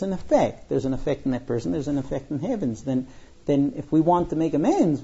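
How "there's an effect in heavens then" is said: ordinary delivery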